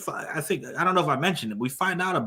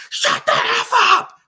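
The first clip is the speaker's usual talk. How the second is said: shrill mock scream, much higher and louder, slow; 9 dB louder, slower than usual. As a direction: scream it out of nowhere, hammed-up. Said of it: "and just scream".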